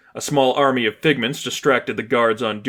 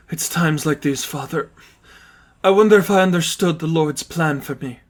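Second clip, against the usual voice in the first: tearful nun; 5 semitones higher than usual. Acted. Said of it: "Sister Katya's cries had settled".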